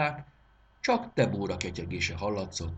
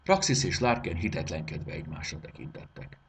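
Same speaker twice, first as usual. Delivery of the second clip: plain reading voice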